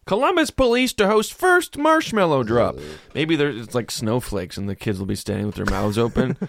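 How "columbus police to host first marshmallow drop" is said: headline announcer voice. Acted.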